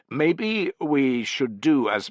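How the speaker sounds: hesitant, stretched-out proposal; tentative suggestion. A narrator's reading. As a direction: venture it cautiously, word by word. Junior officer tentatively suggests, interrupted.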